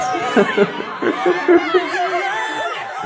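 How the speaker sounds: shrill burst of laughter; high squealing laugh. Real, not acted. Laughs after hot mouthful.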